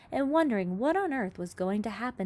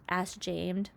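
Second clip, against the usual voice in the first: usual speaking voice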